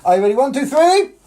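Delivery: rising, shouted one two three; excited countdown. Acted. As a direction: count in high and loud to the kids. Counting kids in again.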